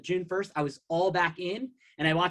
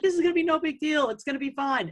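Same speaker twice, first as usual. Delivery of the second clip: a higher, mocking voice brushing off the lockdown; mock carefree; 9 semitones higher than usual. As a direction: imitate a naive "no big deal" crowd. Mocking carefree pre-lockdown attitude.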